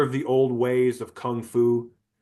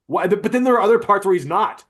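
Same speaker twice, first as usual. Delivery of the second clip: ordinary talk, nothing marked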